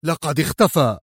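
mildly nervous admission; slightly edgy confession. A narrator's reading. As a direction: let out bad news with a touch of agitation. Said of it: "وهو يقول في عصبية".

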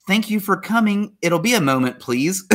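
high, dainty mock-girl voice; put-on feminine courtesy; polite girls' reply. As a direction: pitch it up and answer ever so politely. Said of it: "So, girls".

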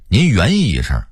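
"言语"说成"原意"; 北京腔说"原意"; Beijing pronunciation. Shown as a demonstration. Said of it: "用北京话说是原意". (北京话变音说"原意")

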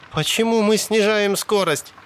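high woman's voice; high, complaining female character; puzzled question. A narrator's reading. Sally questions the slowing car.

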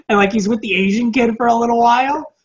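ordinary talk

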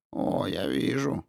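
slow, sarcastic drawl; snide agreement. A narrator's reading. Investigator eyeing the forgotten drink, snidely agreeing. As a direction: agree with sly mockery, drawing it out. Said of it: "ехидно согласился Кин".

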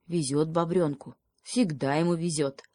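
ordinary delivery, nothing marked

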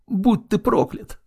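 high, slow, strained cry; anguished curse. Acted. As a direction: spit the curse out in pain. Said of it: "woman cursing in agony".

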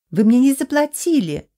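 high, loud squeaky screech; squeaky shriek. A narrator's reading. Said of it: "писклявым голосом заверещала блондинка".

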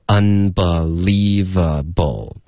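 "unbelievable" very slowly, each syllable apart. Shown as a demonstration. Teacher showing learners there is no linking, syllable by syllable. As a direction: spell out "unbelievable" by sound, un starting cleanly. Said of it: "没有连读，他就直接说".

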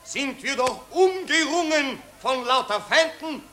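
pompous orator addressing the crowd; patriotic street speech. Acted. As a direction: harangue the citizens with fake grandeur. (pathetische Straßenrede)

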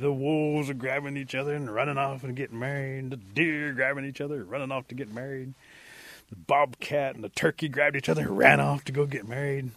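ordinary delivery

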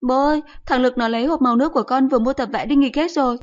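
put-on high, loud child's complaint; child tattling to dad. A narrator's reading. Child telling dad about the stolen paints, high aggrieved yell. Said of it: "bao tiếng chửi vọng lại".

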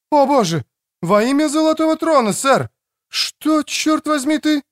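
high-pitched startled woman's outcry. Acted. Startled Элина to the man with the pistol, high outcry.

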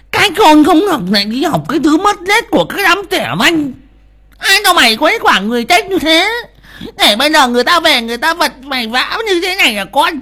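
very high, loud mother's tirade; angry scolding. A narrator's reading. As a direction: shriek curses at your foolish son. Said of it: "mà chửi". (bà mẹ chửi con the thé)

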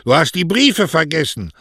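high, loud call down the street; calling after someone. A narrator's reading. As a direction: shout after the departing rider. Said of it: "hinter Mario herrief".

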